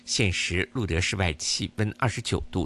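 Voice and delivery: ordinary delivery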